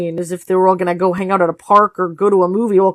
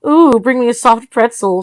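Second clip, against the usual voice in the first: teasing; 5 semitones higher, 6 dB louder than usual. Acted. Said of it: "she teased".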